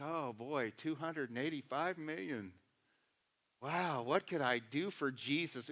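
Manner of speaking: hushed imagined voice gazing at the billboard; daydreaming lottery dreamer. Retold or imitated. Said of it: "And every day it's like".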